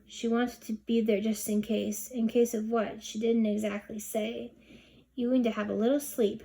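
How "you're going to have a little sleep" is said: usual speaking voice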